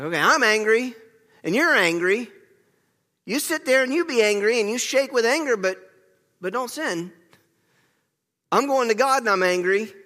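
mock angry outburst. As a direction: let the anger spill out, half acted.

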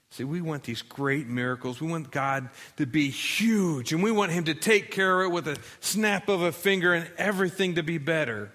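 ordinary delivery

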